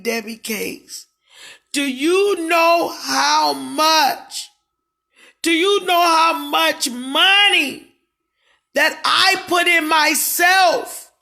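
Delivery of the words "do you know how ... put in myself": loud, slow, higher demand; indignant shout. Real, not acted. Asking viewers about the money she spent, loud and indignant. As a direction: hammer the question home.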